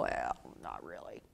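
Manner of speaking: very low, soft, near-whispered well not really; deflated mumble. Shown as a demonstration. Answering praise for animal skills, quietly deflating. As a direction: sink into the brush-off. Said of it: "and you go".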